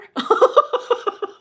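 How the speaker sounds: high breathless laugh; airy laugh. Real, not acted. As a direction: giggle at your own joke. Laughing at her own joke.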